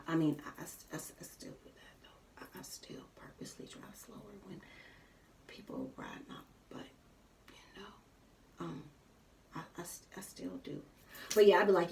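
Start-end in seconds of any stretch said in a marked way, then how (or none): 0.5-10.8 very soft, half-whispered aside; hushed admission. Real, not acted. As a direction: drop almost to a whisper, owning up. Sheepish aside admitting the habit.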